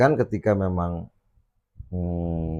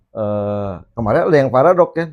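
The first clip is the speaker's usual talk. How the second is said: ordinary delivery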